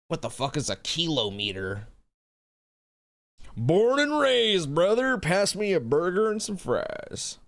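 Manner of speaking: high, slow, hammed-up American dude; mock American bro. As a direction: play the proud burger-loving American. Mocking stereotypical American after teasing.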